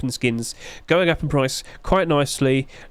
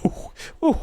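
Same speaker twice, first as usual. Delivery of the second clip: breathy laughing ooh; 12 semitones higher than usual. Real, not acted. Reacting to low subscriber count.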